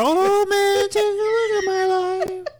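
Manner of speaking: high falsetto wail, loud. Acted. Husband complaining to [name] about clothes, high wailing impression. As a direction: wail it like a plaintive rock singer. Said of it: "Sounds just like him. I, I nailed [name]".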